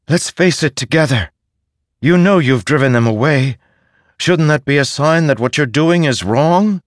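earnest, urgent plea; pleading spirit voice. Acted. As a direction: beg him to face it together. Syl pleads with Ishar.

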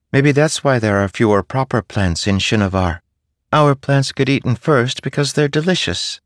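a cheeky little spren musing; playful spren voice. A narrator's reading.

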